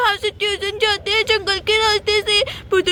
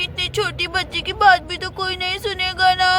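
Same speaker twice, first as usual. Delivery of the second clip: ordinary talk, nothing marked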